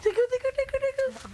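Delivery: very high playful squeak; squeaky tickling voice. Real, not acted. Tickling a housemate.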